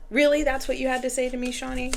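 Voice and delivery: high-pitched, incredulous complaint; indignant disbelief. Real, not acted. Indignant challenge to the deck.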